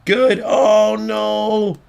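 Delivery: high, loud gamer's outcry; excited outcry. Real, not acted. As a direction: cry out as the chance slips away. Chance goes wrong while playing.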